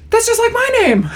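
high, squeaky mock excitement; high-pitched clueless glee; naive delight. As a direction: imitate someone dimly thrilled, pitch way up. Naive birth mother's delighted remark.

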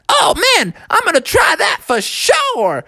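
put-on high, thrilled kid; Froggy. Boy wanting to copy the slide, thrilled. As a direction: exclaim it high and eager. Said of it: "And Froggy was like".

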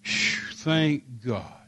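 big exhaled phew, then relieved words; relief. Retold or imitated. Fiancé hearing it was only people, exaggerated relief. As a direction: blow out the breath, then settle into relief. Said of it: "I literally did like this".

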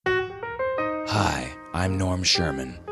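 ordinary delivery

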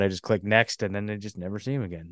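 ordinary delivery

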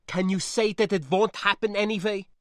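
put-on high, loud accented challenge; anxious niece. Niece asking spacer to promise survival, distressed. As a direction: demand reassurance, upset.

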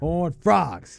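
Horn Frogs in mimicked announcer voice, weight on FROGS; stress on Frogs. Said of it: "put so much emphasis".

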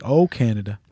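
dry little amazement; low wry nod to Canada; wry aside. Retold or imitated. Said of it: "And I was like".

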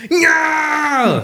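very high hammed-up Schwarzenegger yell; Arnold Schwarzenegger shout. Host yells in a mock Schwarzenegger voice. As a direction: bark it like Arnie. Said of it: "That was actually Arnold Schwarzenegger".

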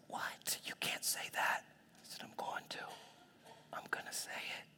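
hushed, voiceless whisper; retold exchange in a hushed whisper; conspiratorial whisper. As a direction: whisper it like a forbidden secret. Hushed shock at forbidden statement.

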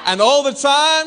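put-on loud, high American-accented call. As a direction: lean into an American preacher accent. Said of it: "I went American just then".

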